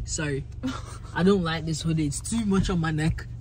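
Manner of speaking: ordinary talk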